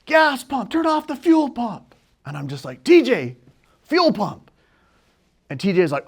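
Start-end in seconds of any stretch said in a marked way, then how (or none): 0.0-1.9 high-pitched urgent call, imitated; raised, carrying voice with urgency; distant yell. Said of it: "this guy off in the distance who yells". 2.8-4.5 raised, higher voice, reenacted; sharp relayed call; urgent calling out. Relays the warning to pilot.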